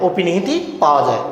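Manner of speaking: normal talking voice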